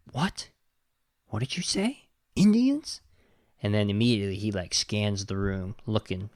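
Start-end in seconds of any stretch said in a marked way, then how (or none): 0.1-3.0 mock disbelief, rising high on each question; hammed-up shocked double take; incredulous cowboy.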